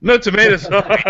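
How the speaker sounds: laughing echo, high and loud. Real, not acted. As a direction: laugh while repeating. Host echoes, laughing.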